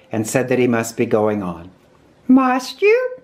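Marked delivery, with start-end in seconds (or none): 2.3-3.2 high-pitched polite question; polite Rabbit. A narrator's reading. Rabbit to a departing guest, high and polite. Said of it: "said Rabbit politely".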